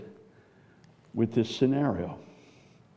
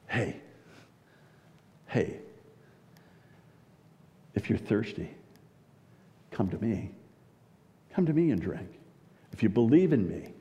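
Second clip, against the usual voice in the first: gentle invitation. Acted. Jesus gently invites the thirsty.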